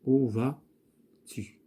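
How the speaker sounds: "où vas-tu" drawn out slowly word by word; slow dictation. Shown as a demonstration. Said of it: "on va dire".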